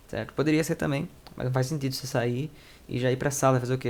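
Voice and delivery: normal talking voice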